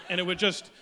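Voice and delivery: ordinary delivery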